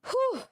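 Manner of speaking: high puffed-out whew; tired whew. Real, not acted. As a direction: sigh it out after a pause. Exhale after long pause.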